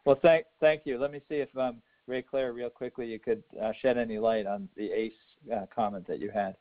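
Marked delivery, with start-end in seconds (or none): none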